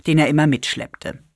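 ordinary delivery, nothing marked

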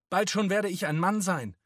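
high, loud outburst; boy's defiant shout. A narrator's reading. Josef struggling in the men's arms, shouting defiantly. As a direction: scream it defiantly at the men. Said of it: "schrie er sie an".

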